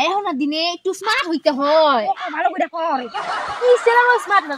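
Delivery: ordinary talk, nothing marked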